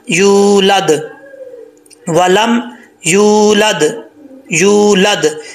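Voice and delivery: यूलद with a long drawn यू; long vowel. Shown as a demonstration. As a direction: stretch the यू slowly. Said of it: "लंबा करके पढ़ेंगे".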